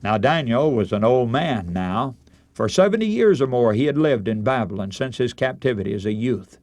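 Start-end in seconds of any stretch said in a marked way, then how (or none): none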